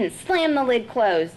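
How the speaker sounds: ordinary delivery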